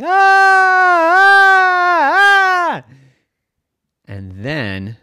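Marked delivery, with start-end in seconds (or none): 0.0-2.8 high, loud repeated screams; screaming. A narrator's reading. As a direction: scream like a crowd of frightened kids. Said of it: "they all started screaming".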